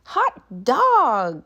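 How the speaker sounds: loud, high whoop of joy; cried out. Acted. Class cheers the principal's exit, loud and gleeful. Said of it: "cried the class".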